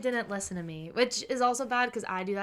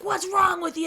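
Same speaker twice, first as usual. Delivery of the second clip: high, louder indignant snap at another driver; mock road rage; 9 semitones higher, 6 dB louder than usual. Retelling her road rage at a beeping driver, mock indignant yell. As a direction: play the offended driver yelling back.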